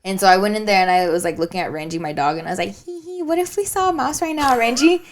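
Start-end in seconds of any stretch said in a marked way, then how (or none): 2.8-5.0 higher playful sing-song; playful tease to the dog; teasing the dog. Retold or imitated.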